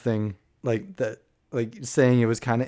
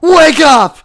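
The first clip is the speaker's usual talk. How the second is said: sudden shout, much louder and higher; screaming; 15 dB louder than usual. Pretend. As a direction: scream it out of nowhere. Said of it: "you just scream".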